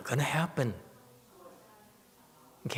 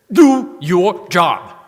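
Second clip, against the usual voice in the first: slow, much louder and higher order; commanding protector; 7 semitones higher, 16 dB louder, much slower than usual. Retold or imitated. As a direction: drag it out, demanding the protector act. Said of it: "I was very upset".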